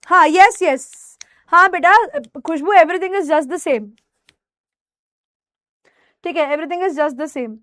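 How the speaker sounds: ordinary talk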